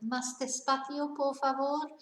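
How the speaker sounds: Más despacio, por favor said slowly and politely; clear, gentle short request; slow Spanish demo. Shown as a demonstration. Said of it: "just say".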